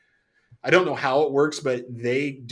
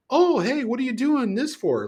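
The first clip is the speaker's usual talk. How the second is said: high, bright customer-service voice; sweet clerk question; 12 semitones higher than usual. Retold or imitated. Clerk politely quizzing a customer, high sing-song. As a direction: chirp it like a polite cashier. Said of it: "trained to ask the question".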